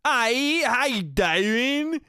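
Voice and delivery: high, drawn-out British caricature; mock British accent. American impersonating a British person, hammed-up high British accent. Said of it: "I'll impersonate a British person".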